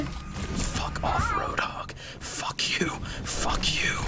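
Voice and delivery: hushed unvoiced cursing; whispered curse. Real, not acted. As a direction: hiss curses under your breath.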